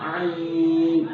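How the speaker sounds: آئین from the throat, drawn out slowly with the madd; slow recited آئین; long throaty ain. Shown as a demonstration. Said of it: "یہیں سے نکلتا ہے".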